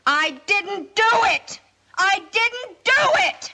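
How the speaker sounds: high, slow, frantic crying out; screaming the denial, falling apart; hysterical denial. Acted.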